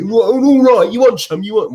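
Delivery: loud, high squared-up challenge; mock hard-man challenge. Outside a pub, he squares up to the man hassling his mate, loud and high. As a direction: puff up and call him out for a fight.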